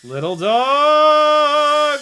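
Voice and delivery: high, slow, stretched sing-song coo at the dog; calling to a dog. Real, not acted. Drone pilot spots a little dog below, drawn-out high coo. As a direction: draw it out high and loud like cooing at a puppy.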